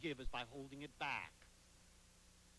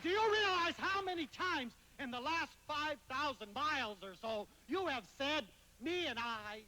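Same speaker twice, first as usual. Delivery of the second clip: scolding; 9 semitones higher, 5 dB louder than usual. Retold or imitated. Scolding the kid on a plane.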